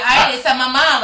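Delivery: hammed-up Spanish exclamation; imitated fond guards. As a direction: hail her like an amused guard. Imitating guards greeting the mother.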